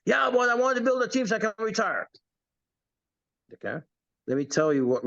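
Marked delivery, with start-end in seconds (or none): 0.0-2.1 high mocking voice of a naive recruit. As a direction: ham up a clueless newbie. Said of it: "they say some dumb things like".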